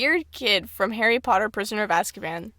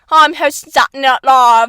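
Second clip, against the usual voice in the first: mock British accent; 2 semitones higher, 9 dB louder than usual. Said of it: "that brand of British where it's like".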